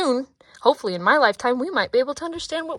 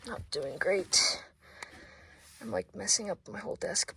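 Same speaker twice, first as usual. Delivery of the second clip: whispered; 2 semitones lower, 8 dB quieter than usual. Real, not acted. Vlogger whispering an honest admission to viewers. Whispered repeat of not doing well.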